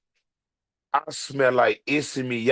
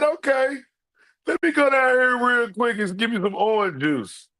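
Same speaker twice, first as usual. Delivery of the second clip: high excited self quote; 7 semitones higher than usual. Retold or imitated. Retells hopeful breakfast trip.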